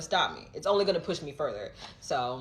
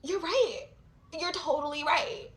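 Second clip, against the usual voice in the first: mock realization; 10 semitones higher, 5 dB quieter than usual. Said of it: "is gonna make me be like".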